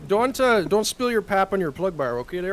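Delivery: high, loud hammed-up Minnesota voice; a mock Minnesota folksy warning; exaggerated Minnesotan accent impression. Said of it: "my Minnesotan wife".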